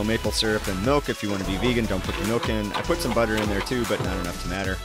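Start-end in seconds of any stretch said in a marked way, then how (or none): none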